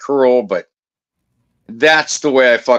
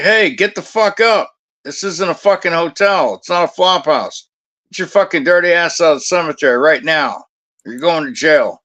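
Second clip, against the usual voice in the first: mock-stern wake-up call; retold angry rousting; 6 semitones higher than usual. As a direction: re-enact ordering trespassers out. Rousting sleepers out of the cemetery.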